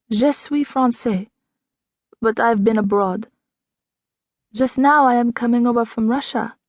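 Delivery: put-on French accent, stranger telling where she's from. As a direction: lean into the French accent, unhurried. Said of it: "Sounds like Quebec".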